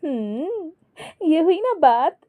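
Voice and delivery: high, pleased teasing voice; wife happily teasing her husband; happy. A narrator's reading. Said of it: "Jully खुश होकर".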